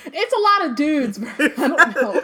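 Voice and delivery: giggling while talking; laughing. Real, not acted. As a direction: speak through real laughter. Laughing at a joke.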